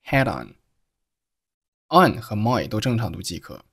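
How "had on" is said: "head on" for had on, joined as one; linked words. Shown as a demonstration. Teacher showing learners linking, "head on" run together. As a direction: slide the d into on, "head on". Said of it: "had和on连读成了".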